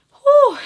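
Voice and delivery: high, loud exhale of nerves; loud whew. Real, not acted. Vlogger steadying herself before her news, anxious whew. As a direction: let out a big high whew.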